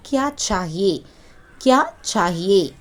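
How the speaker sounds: கியா சாஹியே as "kyaa chaa-hi-ye", opening with ch; Hindi pronunciation. Shown as a demonstration. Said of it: "pronounce பண்ணணும்". (கியா சாஹியே, இந்தி உச்சரிப்பில் "க்யா ச்சாஹியே")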